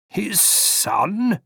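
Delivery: high, soft and slow, puzzled; dreamy old gentleman searching his memory; a vague old man trying to remember, quietly. Acted.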